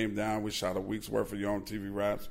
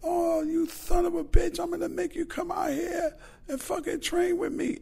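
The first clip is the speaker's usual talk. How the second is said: high shouting Tyson impression. Retold Tyson challenge shouted.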